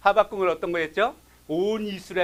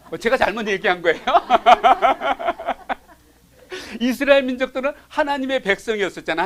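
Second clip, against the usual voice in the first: laughing through the words, louder; amused laughter at no answer; 5 semitones higher, 6 dB louder than usual. Real, not acted. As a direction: chuckle at the silent room while talking. Laughs at silent congregation.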